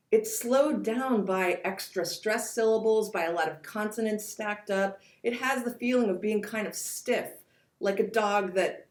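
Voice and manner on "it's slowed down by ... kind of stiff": ordinary delivery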